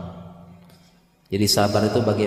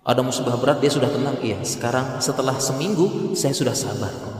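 ordinary talk

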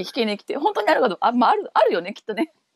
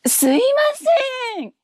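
stage line; 14 semitones higher than usual. Shown as a demonstration. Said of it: "セリフ一言だけ".

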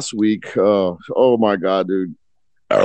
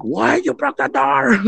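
mock shocked wife; 14 semitones higher, slower than usual. Wife hears the door broke.

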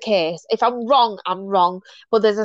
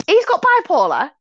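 higher, louder-edged imitation of gossips; mock pointing out; 9 semitones higher, 4 dB louder than usual. People point at you over bipolar, mock announcement. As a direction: mimic someone announcing a discovery.

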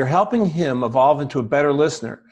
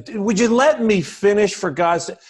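higher, louder "would you let me finish"; exasperated; 7 semitones higher, 4 dB louder than usual. Shown as a demonstration. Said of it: "adjusting your intonation so you're not saying".

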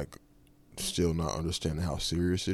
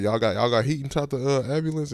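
loud demanding question; 9 semitones higher, 9 dB louder than usual. Retold or imitated. Asking paramedic about heat.

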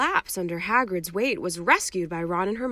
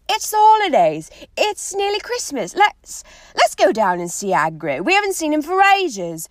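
much higher, bright and eager; Ron changing subject; 6 semitones higher, 8 dB louder than usual. Acted. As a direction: bounce in with a new idea.

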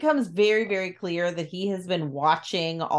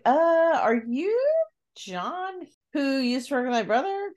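mock surprise, higher and cracking; high, wavering disbelief; startled question; 6 semitones higher than usual. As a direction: caught off guard by a stranger. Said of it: "she's like".

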